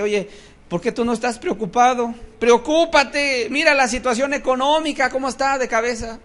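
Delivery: high, loud imitation of a worrier; mock alarmed urging. Worrier scolding someone at peace about the economy, mock alarm. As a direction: play an agitated acquaintance insisting on worry. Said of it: "la gente cuando está en esta paz dice".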